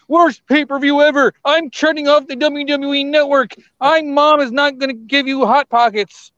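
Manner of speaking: high-pitched mock fan tirade; outraged fans. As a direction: whine like an angry wrestling fan. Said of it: "everybody's gonna go".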